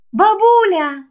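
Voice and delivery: put-on high, bright child's cry; little girl calling. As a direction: call out like a little girl waking. Healed child cries out.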